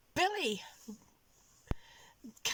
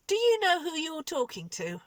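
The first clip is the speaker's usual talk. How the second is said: stern manager rebuke; 9 semitones higher, 6 dB louder than usual. Retold or imitated.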